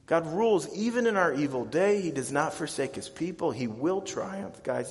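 ordinary delivery

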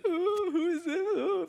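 fake-cry it through the tears; mock sobbing. Pastor imitating Mary crying at the tomb, hammed-up mock sobbing. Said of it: "she's boohoo crying".

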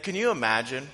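usual speaking voice

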